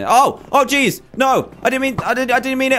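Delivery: high, startled, pleading; panicked yelp. Real, not acted. Gamer startled by pirate captain, high panicked pleading. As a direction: panic as the captain turns on you.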